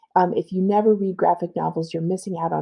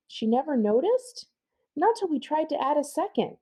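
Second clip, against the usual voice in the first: put-on higher kid's voice; gossiping kid; 8 semitones higher, 3 dB quieter than usual. Kids gossip about the witch's hair in higher voices. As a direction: tease like schoolkids.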